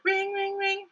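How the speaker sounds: high sung ring ring; playful phone ring sound; mock phone ring. Shown as a demonstration. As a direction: imitate a phone ringing, lightly. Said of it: "So here it is".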